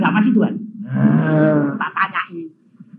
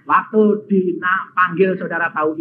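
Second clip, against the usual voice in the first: usual speaking voice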